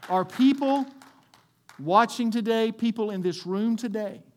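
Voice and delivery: very loud, high preacher's call; full-force preaching. Acted. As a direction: proclaim it to the whole room.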